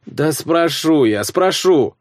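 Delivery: drowsy, slow grumble; sleepy child. A narrator's reading. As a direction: mumble it half-asleep, annoyed. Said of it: "сонно пробормотал Славка".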